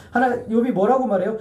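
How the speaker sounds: ordinary delivery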